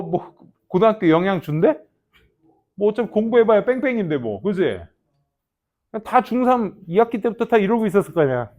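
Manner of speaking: normal talking voice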